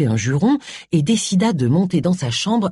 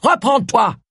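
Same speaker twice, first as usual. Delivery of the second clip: sharp, higher-pitched order; curt command; 5 semitones higher, slower than usual. A narrator's reading. Charles tells sobbing Agatha to compose herself, curt sharp order. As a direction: order it curtly, no sympathy. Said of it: "dit Charles d'un ton sec".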